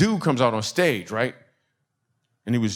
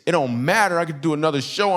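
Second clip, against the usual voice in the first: ordinary talk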